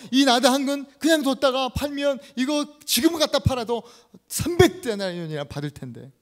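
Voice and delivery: loud, high indignant protest; shouted complaint. Acted. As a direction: act out a disciple's loud grumbling. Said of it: "막 소리를 칩니다". (소리 높여 따지는 제자)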